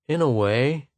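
wary, lifted tone; cautious. A narrator's reading. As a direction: answer guardedly. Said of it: "said Arbin cautiously".

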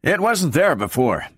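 short, slightly high dismissive reply; curt. A narrator's reading. Powell explains the food shelves to his partner, curtly. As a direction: answer tersely and get it over with. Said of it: "said Powell curtly".

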